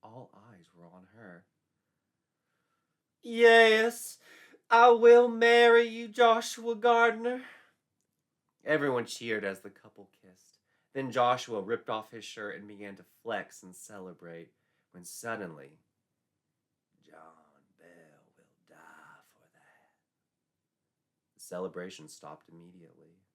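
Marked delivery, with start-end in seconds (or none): 3.2-7.5 put-on very high, loud girlish yes; delighted acceptance. A narrator's reading. Betsy accepts the proposal. 17.0-19.9 slow, very soft whispered curse; witch whisper. A narrator's reading. Witch's sudden threat.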